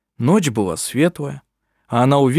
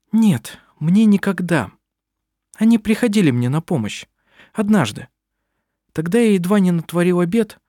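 higher-pitched, lightly voiced mother confiding; rueful mother; 7 semitones higher than usual. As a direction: confess it with mild regret, pitched up. (слегка сыгранная мать с сожалением)

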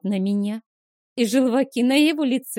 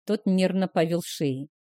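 usual speaking voice

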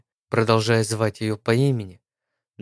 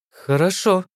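puzzled, high agreement; slightly surprised; 8 semitones higher, 3 dB louder than usual. A narrator's reading. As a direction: sound a little taken aback. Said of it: "несколько удивлённо ответила Лиза".

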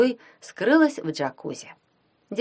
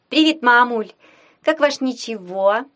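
cheerful greeting; 5 semitones higher, 5 dB louder than usual. Acted. Daughter cheerfully calling.

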